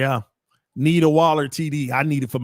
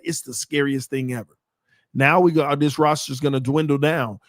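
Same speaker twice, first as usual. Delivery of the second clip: ordinary delivery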